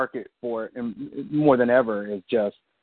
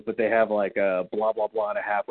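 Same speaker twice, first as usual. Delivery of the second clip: ordinary talk, nothing marked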